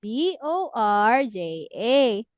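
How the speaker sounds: slow, letter by letter; spelling out letters. Shown as a demonstration. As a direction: spell each letter slowly and apart. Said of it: "So we will spell it".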